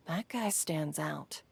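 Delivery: slow, hushed whisper. Acted. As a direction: lean in and whisper it. Aside about the stranger nearby.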